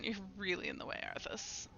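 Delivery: ordinary delivery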